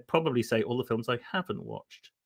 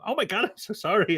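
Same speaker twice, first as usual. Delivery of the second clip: higher-pitched mock American woman; poor American accent; 7 semitones higher than usual. American passenger apologising to two travellers, higher poor American accent. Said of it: "apologies for the poor American accent".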